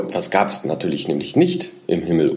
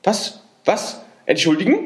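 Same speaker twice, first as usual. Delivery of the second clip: high indignant squeak, „Entschuldigen?“ rising; squeaking with agitation; 11 semitones higher than usual. A narrator's reading. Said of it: "quiekte das Männchen ganz rot vor Aufregung".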